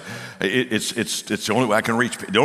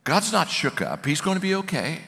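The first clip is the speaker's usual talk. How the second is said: high, gentle comforting voice; gentle reassurance; 7 semitones higher, 7 dB quieter than usual. Retold or imitated. Coach telling agnostic colleague God isn't upset, soothingly. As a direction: soothe him in a raised, easy voice.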